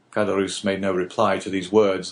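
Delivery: ordinary talk, nothing marked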